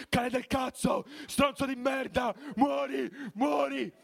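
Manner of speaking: high furious shouting; angry shouting. Retold or imitated. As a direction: hurl insults at full voice. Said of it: "insultandolo in tutti i modi".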